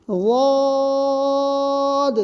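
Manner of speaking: واد held long on a steady tone; long recitation واد; recited letter. Shown as a demonstration. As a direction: chant واد very slowly. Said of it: "اس کا ادا کرنا بہت ہی کٹھن ہے".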